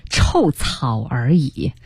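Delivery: slow, low, cutting dismissal; scornful. Acted. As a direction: sneer it out slowly. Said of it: "很刻薄地说".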